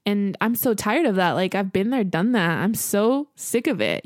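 ordinary delivery

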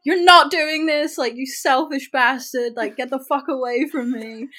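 loud angry yell at him; shouted you selfish bastard; retold scream. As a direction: scream it in his face. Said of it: "I just scream".

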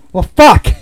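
the spam caller giving up, mock defeated curse; mock deflated caller. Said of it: "they're just like".

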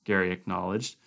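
ordinary delivery

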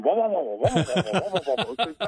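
repeated mock engine drone, chuckling through it; laughing mower noise impression; imitating mower engine. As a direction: buzz it out like a runaway lawnmower. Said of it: "all I can hear the mower is going".

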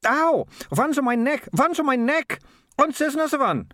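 acted panic, pitched right up; panicked shriek. A narrator's reading. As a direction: squeal as if rats are climbing on you. Rats climbing on the girl.

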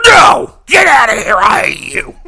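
hammed-up screaming rage at a child; sudden furious yell. Father screams at his daughter over ice cream, furious yell. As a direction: explode high and loud on No.

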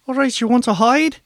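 high, loud flustered voice; panicked Tedby voice. Acted. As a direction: babble in a nervous panic. Tedby voice again.

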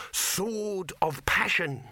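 slow, high, dramatic announcement; grand title reveal. A narrator's reading. Dibbler unveiling his film title, slow and grand. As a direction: proclaim it with stretched words.

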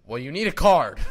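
very loud mock clerk, near laughter; loud mocking imitation. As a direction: blurt out the clerk's line, mocking.

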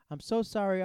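high, loud, panicky apology; acting apologetic. Pulled-over young driver to the cop, acting apologetic. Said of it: "I just start acting".

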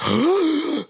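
sharp, high mock gasp; shocked gasp. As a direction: gasp as if you just walked in on it. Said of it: "I was just like".

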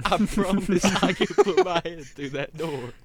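loud, slow, laughing promise; loud laughing boast. Real, not acted. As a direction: boast it loudly through laughter. Excited boast over laughter.

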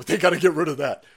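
normal talking voice

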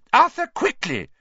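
high, loud shout; wife's high-pitched frantic call; urgent cry. A narrator's reading. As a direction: cry out to hurry a husband. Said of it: "cried Missus Weasley".

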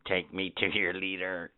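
low, soft alien demand. Acted. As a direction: ask like a classic movie alien.

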